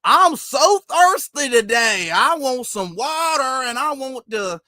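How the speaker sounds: high, slow, stretched mock Southern drawl; overdone fake Southern accent. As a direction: badly fake a Southern accent, dragging every word. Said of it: "When people, like, overpronounce the word".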